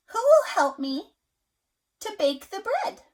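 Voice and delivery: high, loud hen voice; hen asking. A narrator's reading. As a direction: plead brightly for help. Hen asks animals again.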